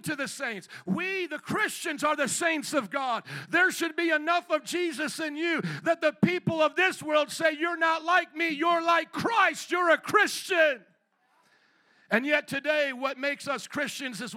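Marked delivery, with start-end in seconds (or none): 3.5-10.8 urgent pulpit delivery, ringing out; forceful preaching. Real, not acted. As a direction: preach it hard to the congregation.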